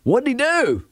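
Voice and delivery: put-on high curious kid voice; child voice. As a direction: ask it like a curious little kid. Kid's question in the story.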